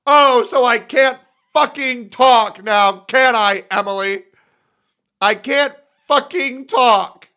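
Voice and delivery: hammed-up indignant shout; indignant mock outburst. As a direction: overact being offended. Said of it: "I believe I said it just like this".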